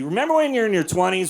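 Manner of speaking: normal talking voice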